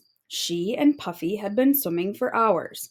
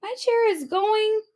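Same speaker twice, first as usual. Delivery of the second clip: put-on very high, dismayed baby voice; a little one watching her chair float away; baby octopus wails as crabs take her chair; baby octopus crying out; 12 semitones higher, slower than usual. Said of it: "she cried".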